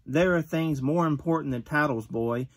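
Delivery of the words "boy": usual speaking voice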